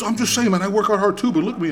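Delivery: high, loud mock protest; defensive stranger. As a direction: imitate him pleading his case. Retold other guy defending himself.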